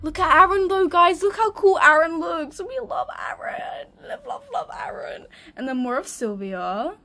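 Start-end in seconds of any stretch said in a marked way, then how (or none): 0.0-2.6 high, loud fangirl gushing; excited gushing. Real, not acted. Gushing over a drawing. 2.6-5.2 tiny, very high fangirl coo; adoring squeal. Real, not acted. Cooing over a favourite drawing.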